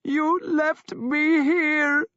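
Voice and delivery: high, slow, loud grieving wail. A narrator's reading. Sister wailing at her brother over being abandoned. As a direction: let the grief tear out of her. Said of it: "Thyra wailed".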